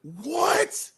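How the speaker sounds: high, rising, drawn-out disbelief; real astonishment. Real, not acted. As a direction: react stunned to a wild story. Listener stunned by the story.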